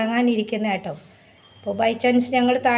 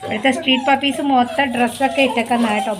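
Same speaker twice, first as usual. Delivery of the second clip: normal talking voice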